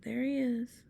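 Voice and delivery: ordinary delivery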